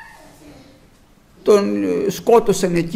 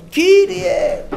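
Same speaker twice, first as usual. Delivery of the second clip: a loud, desperate cry to the Lord; 10 semitones higher, 3 dB louder than usual. Acted. Joshua crying out to the Lord after the defeat, loud desperate call. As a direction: call out to God in alarm. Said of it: "φώναζε".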